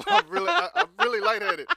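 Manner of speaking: high giggly laugh through every word; real laughter bubbling over the words; giggling. Real, not acted. Cohost giggles, admitting she is lightheaded. Laughing while dizzy on air.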